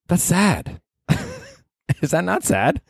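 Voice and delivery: high whiny mock self-pity; a put-on sad little voice. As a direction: whine it like a pitiful kid.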